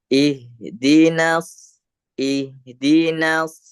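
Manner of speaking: "Ihdinas" chanted slowly with a thin, front A. Shown as a demonstration. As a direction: keep the last vowel of "ihdinas" light, not rounded. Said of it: "Na tetap posisinya tipis". ("na" pada "ihdinas" dibaca tipis)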